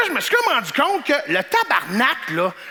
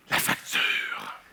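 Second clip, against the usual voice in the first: low whispered la facture; hushed; 7 dB quieter, much slower than usual. Acted. Dreading girlfriend's receipt, whispered. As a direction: breathe it out in dread.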